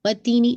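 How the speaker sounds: وَالتِّینِ with the نی sound fully completed; tajweed demo. Shown as a demonstration. Teacher modelling a complete نی in وَالتِّینِ. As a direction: finish the نی of وَالتِّینِ clearly and sharply. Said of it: "نی کا sound complete کر کے پورا".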